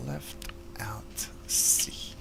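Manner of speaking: slow whispered key command. Shown as a demonstration. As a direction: whisper the key combo slowly. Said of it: "If I say okay".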